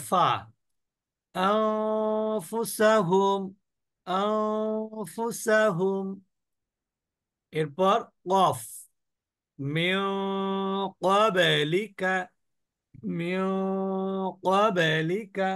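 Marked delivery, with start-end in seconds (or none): 1.4-6.1 আনফুসাহুম very slow, long nasal before "fu"; ghunnah demo. Shown as a demonstration. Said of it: "অনিশ্বারের মতন করে গুণনা করছি". 9.6-15.5 মিন with its "n" held as a nasal hum, then slow ক্বাবলিকা; ghunnah demo. Shown as a demonstration. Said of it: "অনিশ্বারের মতন করে".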